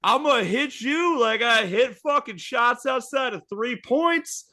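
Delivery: very high, loud shouting trash talk; mock fury. Angel Reese trash-talking the monster, high shouting. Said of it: "Then she goes".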